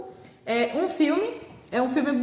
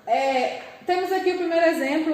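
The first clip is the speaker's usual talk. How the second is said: ordinary talk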